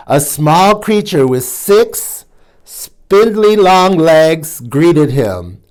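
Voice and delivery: ordinary delivery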